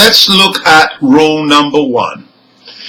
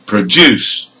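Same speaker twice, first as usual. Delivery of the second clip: "produce" stressed on DUCE, weak start; last-syllable stress; 4 semitones lower than usual. Shown as a demonstration. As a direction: lean on the end of "produce". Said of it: "have stress on the".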